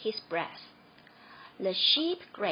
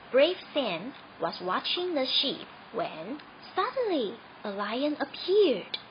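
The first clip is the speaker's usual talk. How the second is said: usual speaking voice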